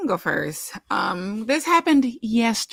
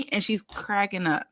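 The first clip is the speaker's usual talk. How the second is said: ordinary delivery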